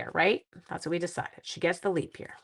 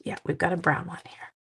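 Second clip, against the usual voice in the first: normal talking voice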